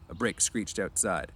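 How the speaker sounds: usual speaking voice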